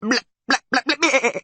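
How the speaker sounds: high, loud furious bleating gibberish; furious gurgling. A narrator's reading. Creature rages at the travellers, gurgling furiously. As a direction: bleat furiously in goat babble. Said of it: "The creature gurgled furiously".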